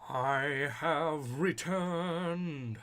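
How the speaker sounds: dramatic return. Pretend. Mock grand comeback line.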